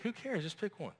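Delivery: high, then trailing quiet, mock impatience; dismissive brush-off. Listener brushing off a silly dilemma, dismissive. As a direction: wave the dilemma away lightly. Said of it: "You're like".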